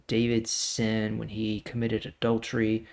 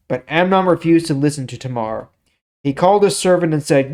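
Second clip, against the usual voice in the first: measured storytelling read of scripture; story reading; 3 semitones higher, 8 dB louder than usual. Acted. Teacher reading the Bible story to viewers, steady narration. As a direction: read the passage like a story to listeners.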